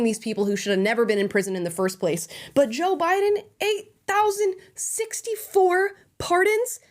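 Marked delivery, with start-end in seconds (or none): 2.5-6.8 high, slow, rising disbelief; much higher, stretched-out mock outrage; incredulous. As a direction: let the number rise up in shocked disbelief. Outraged disbelief at pardon number.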